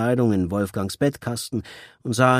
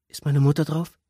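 higher, anxious asking; anxious question; 7 semitones higher than usual. A narrator's reading. Daughter asking father about her mother, anxiously. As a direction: ask it fearing the answer. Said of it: "fragte Julia bang".

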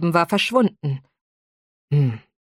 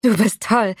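starstruck blurt, higher; 4 semitones higher than usual. Acted. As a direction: blurt it like an awed fangirl. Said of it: "platzte ich heraus".

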